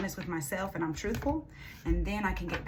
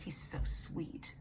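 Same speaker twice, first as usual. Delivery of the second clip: tender coo; 2 semitones lower, 6 dB quieter, much slower than usual. Real, not acted. Tarot reader cooing fondly over the client's little son, soft and slow. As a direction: melt a little as you say it.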